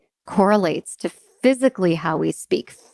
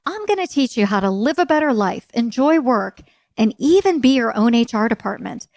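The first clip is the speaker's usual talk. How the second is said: upbeat promo read, higher; book ad read; 5 semitones higher than usual. Acted. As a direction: sell the book like a radio spot.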